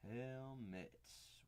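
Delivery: slow, soft, drawn out while writing; dictating to yourself while writing; spelling it out. Real, not acted. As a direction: say each word slowly as you write it. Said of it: "Wow, I can spell".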